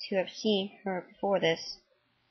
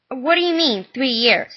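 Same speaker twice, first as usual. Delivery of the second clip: louder, higher alarmed question; 5 semitones higher, 7 dB louder than usual. Acted. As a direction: demand it in alarm. Son alarmed by sentence.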